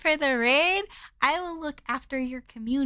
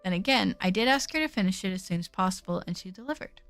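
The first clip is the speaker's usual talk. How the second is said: low, even narration voice; story narration; 5 semitones lower than usual. Acted. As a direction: read the game text calmly. Reads game narration quietly.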